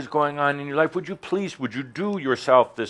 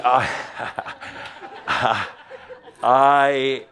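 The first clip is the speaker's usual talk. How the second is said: laughing at own joke; 2 semitones higher, 5 dB louder than usual. Real, not acted.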